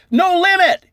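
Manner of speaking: high, loud shout; yelled. Retold or imitated. Player at the poker table yells, high and loud. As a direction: belt it out over the table. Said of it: "somebody at some point yelled".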